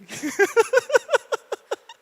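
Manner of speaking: high loud laugh. Real, not acted. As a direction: burst of high-pitched laughter. Riso alto após confissão. (risada alta e aguda)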